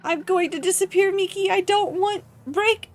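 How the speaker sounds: high, loud cry for help; panicked begging. Acted. Hacked figure screams for help.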